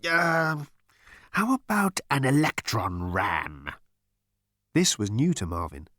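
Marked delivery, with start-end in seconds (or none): 0.1-3.7 tank guessing. Acted.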